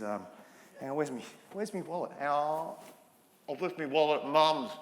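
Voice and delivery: ordinary delivery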